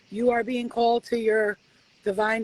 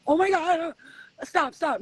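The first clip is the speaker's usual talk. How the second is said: higher, louder hammed-up panic; mock alarm, begging them to stop; mock panicked plea; 7 semitones higher, 4 dB louder than usual. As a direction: pretend to freak out at the visions.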